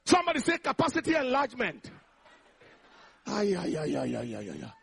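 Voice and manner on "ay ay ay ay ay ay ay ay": low string of ays, dying away; deep, trailing exclamation. Real, not acted. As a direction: let a run of ays sink down and fade. Preacher exclaims after congregation responds.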